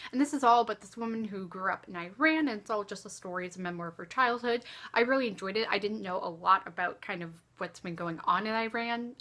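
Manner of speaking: ordinary talk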